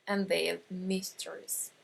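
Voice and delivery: normal talking voice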